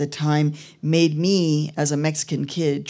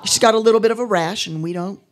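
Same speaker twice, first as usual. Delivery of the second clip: put-on Texan accent; 5 semitones higher, 4 dB louder than usual. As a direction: play a tall Texan immigration officer. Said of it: "I'm gonna do an accent".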